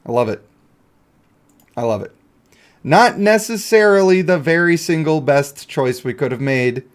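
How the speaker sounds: ordinary delivery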